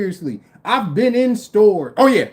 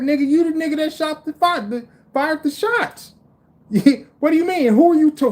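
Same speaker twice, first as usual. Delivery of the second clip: mock outraged rant; 9 semitones higher than usual. Mocking guard calling shots fired.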